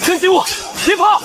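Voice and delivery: high urgent cry in battle; urgent shout. Acted. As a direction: shout protectively over the fighting. Calling out amid an attack. (高声急喊)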